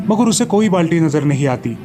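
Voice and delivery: ordinary delivery, nothing marked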